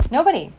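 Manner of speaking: Nobody said high and loud, punched; firm insistent Nobody; emphatic nobody. Real, not acted. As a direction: hit Nobody hard as a final word.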